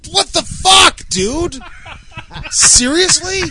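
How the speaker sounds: loud, high shocked outburst; shocked yell. Real, not acted. Friend yelling at his buddy in shock. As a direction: yell it out in disbelief.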